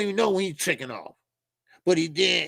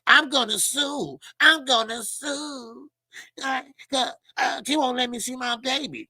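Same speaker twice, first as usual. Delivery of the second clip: mocking Devin; 7 semitones higher than usual. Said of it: "here go Devin".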